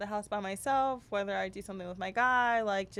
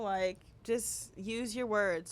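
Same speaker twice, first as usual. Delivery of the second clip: slow deliberate; much slower than usual. Shown as a demonstration. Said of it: "parenting advice delivery".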